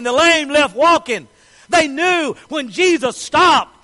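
loud, high, ringing preacher voice; preaching at full force. Acted. Preacher building to a climax before the congregation, loud. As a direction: drive it home to the whole room.

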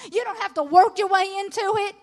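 normal talking voice

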